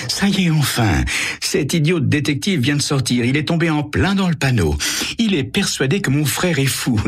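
high, gloating and triumphant; scheming woman's true voice. A narrator's reading. Carole gloats to her lover about the detective, high and mocking. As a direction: drop the mask and relish the con. Carole's secret phone call.

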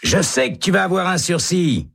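high, insistent cellmate voice; hopeful prisoner. Acted. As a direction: promise him he'll be spared. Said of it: "dit Hennessy". (voix haute de détenu confiant)

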